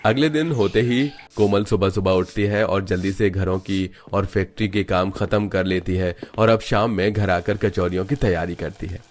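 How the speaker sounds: usual speaking voice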